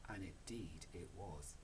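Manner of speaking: ordinary delivery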